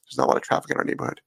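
quick whispered repeat under the breath; whispered aside. Real, not acted. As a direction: toss it off fast in a whisper.

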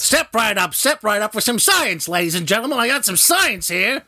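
loud, high carnival barker; snake oil seller. Pretend. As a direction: hawk it like a fairground huckster. Carnival barker hawking science.

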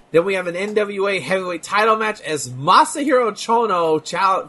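ordinary talk, nothing marked

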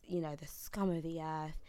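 normal talking voice

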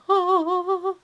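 high, loud starstruck gush; husband awestruck by the fit friend, hammed-up adoring gush. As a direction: gush up at him like a fan. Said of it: "Neil's looking up to him going".

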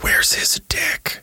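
slow, breathy whispered aside. Retold or imitated. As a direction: whisper it like gossip. Imitated people whispering.

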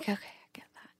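whispered under her breath; hushed mock acceptance. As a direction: breathe it out, barely audible. Retold own reply, whispered.

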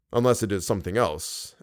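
plain reading voice

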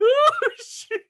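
laughing so hard he cries; helpless, high-pitched laughing fit; laugh attack. Real, not acted. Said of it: "It was a laugh attack".

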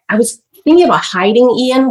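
ordinary talk

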